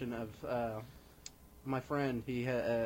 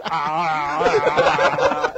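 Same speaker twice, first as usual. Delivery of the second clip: imitated cry; 2 semitones higher, 14 dB louder, much slower than usual. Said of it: "and then his cry is".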